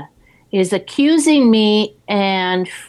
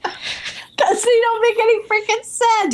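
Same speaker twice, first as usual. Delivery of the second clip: high, half-crying laugh through the words; 13 semitones higher than usual. Real, not acted. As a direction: laugh helplessly while you say it. Laughing about her confusing notes.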